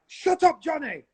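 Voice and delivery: high sharp snap; mock scolding. Retelling being told to shut up. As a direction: bark it like a scolding teacher. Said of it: "I'm like".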